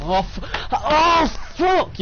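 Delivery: sudden loud yell; shocked shout. Real, not acted. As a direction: blurt it out in shock. Sudden danger mid-game.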